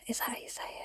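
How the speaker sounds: breathy whispered repeat; whispered self-doubt. Real, not acted. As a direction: whisper it to yourself, embarrassed. Unsure of a word, whispering it.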